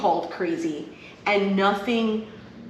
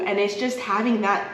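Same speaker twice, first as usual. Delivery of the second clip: ordinary delivery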